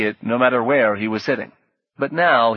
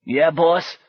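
higher, raspy Brooklyn sidekick; rough little Brooklyn voice, questioning; raspy Brooklyn accent; 6 semitones higher, 4 dB louder than usual. A narrator's reading. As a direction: answer the boss in raspy Brooklynese. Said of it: "said in a raspy Brooklyn accent".

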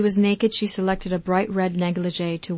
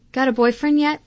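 playful, higher, louder tease; teasing; 5 semitones higher, 4 dB louder than usual. Acted. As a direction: needle her mother fondly. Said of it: "Tracy teased".

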